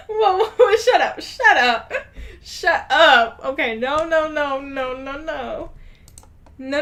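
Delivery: can't keep it together; real laughter; laughing. Real, not acted. Cracking up at the reading.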